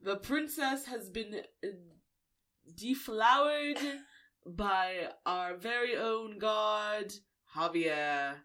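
loud, slow mock proclamation; announcer. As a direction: proclaim it grandly, drawing out each phrase. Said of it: "I like to think the announcement went a lot like this".

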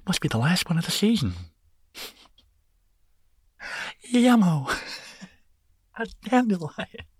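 very high, fast, lisping cartoon sloth; Sid the Sloth. Sid the Sloth finding a dandelion, very high and giddy. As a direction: chirp it out like a giddy goofy sloth. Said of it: "love me some Sid the Sloth".

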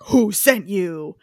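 slow, drawn-out action-hero demand; mock menace, stretching out each word; Liam Neeson. Hero interrogating the thugs he shot, slow menace. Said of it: "Like fucking Liam Neeson in Taken".

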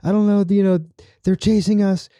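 a lieutenant losing it on the radio; freaking out. Retold or imitated. Lieutenant panicking to the colonel, high and frantic. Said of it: "He's freaking out".

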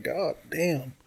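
very soft, hushed amazement; stunned. Retold or imitated. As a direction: let it out under the breath, astonished. Repeats stunned reaction to heroin.

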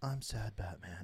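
mopey Batman mock. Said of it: "It was terrible".

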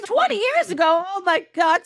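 comic panicked astonishment; mock freak-out. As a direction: blurt it in hammed-up disbelief. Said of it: "she's freaking out".

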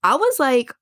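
ordinary delivery

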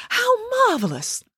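Loretta squealing with glee at the news; delighted exclaim. Acted. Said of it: "clapping her hands in delight".

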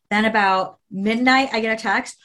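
normal talking voice

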